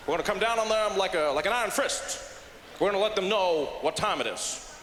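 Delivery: firm presidential impression; measured, resolute speechmaking with halting fillers; Barack Obama. President vowing action over the plane, firm measured impression. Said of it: "It's a great Obama accent".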